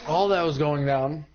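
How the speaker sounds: ordinary delivery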